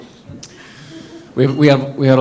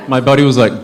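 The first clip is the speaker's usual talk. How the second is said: ordinary talk, nothing marked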